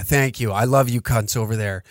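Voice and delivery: usual speaking voice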